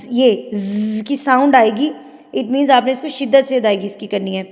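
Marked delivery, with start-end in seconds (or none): none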